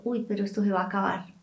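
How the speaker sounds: quick, hushed worried muttering to yourself; quiet nervous inner voice; anxious inner thought. Retold or imitated. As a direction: voice the fearful doubt, soft and rushed. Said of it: "viene el, el pensamiento".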